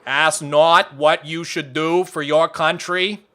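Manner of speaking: slow presidential speech in a mock Kennedy accent. Shown as a demonstration. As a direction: imitate the odd Kennedy accent, drawn out. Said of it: "what Kennedy sounds like".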